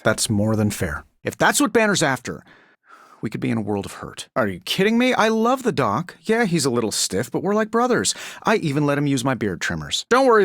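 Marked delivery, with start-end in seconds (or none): none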